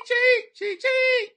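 very high, loud chirps; playful piercing squeal; gleeful name call. Pretend. Playing with fan's name.

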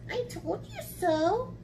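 high sing-song mouse voice; smug mouse. Acted. Mouse proving its boast to Gruffalo, high and smug. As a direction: gloat in a high little voice.

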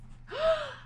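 high gasp of realization; sharp intake at a new idea; acted eureka gasp; sudden gasp. Max has a sudden idea.

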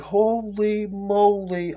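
very slow, high, soft holy moly; awestruck. Retold or imitated. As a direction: stretch each syllable in stunned awe. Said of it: "I was like".

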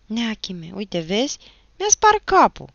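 high, rising whiny plea; boy's plaintive outcry; pleading boy. A narrator's reading. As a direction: protest loudly that you got hurt. Boy pleads, showing his broken head.

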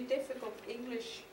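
normal talking voice

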